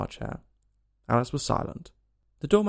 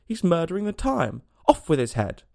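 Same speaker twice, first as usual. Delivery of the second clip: Queen's outraged cry, pitched up; bawling; 6 semitones higher, 4 dB louder than usual. A narrator's reading. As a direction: bawl it out like an indignant queen. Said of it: "the Queen jumped up and bawled out".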